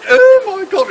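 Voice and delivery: high, loud mock-horrified cry; shocked princess. Princess hears the patient's rude answer, in high mock shock. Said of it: "and she runs out the room".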